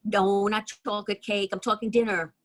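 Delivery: ordinary talk, nothing marked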